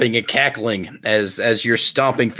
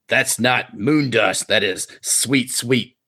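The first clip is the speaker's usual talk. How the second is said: slow reveal; slower than usual. Acted. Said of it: "He said".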